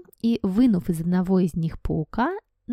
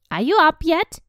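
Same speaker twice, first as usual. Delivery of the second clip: much higher, loud demanding call; demanding aunt; 10 semitones higher, 8 dB louder than usual. Acted. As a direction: bark it through the door. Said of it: "she demanded".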